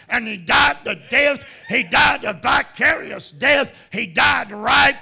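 loud, high, shouted preaching; preaching at full force. Acted. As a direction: thunder it out to the congregation.

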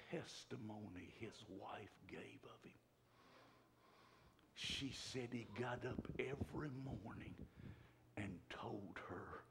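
soft, low, whispered testimony; hushed whispered preaching. Acted. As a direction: draw them in with a hush.

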